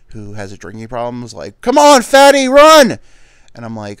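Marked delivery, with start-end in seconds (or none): 1.6-3.0 put-on high, loud bark at a slow kid; coach yelling. Drunk Little League base coach yells at the fat kid: loud, high shout. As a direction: yell at the fat kid to run.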